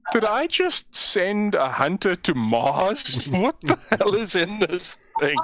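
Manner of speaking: high, drawn-out disbelief; mock astonishment. Gamer after firing a flare gun, astonished. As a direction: react in slow, high-pitched comic amazement. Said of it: "I'm going".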